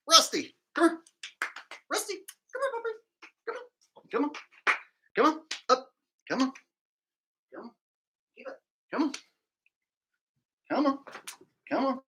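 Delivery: high, far-carrying pet call; high coaxing call to a dog. Real, not acted. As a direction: coax the puppy over in a high sing-song. Calling the dog to come.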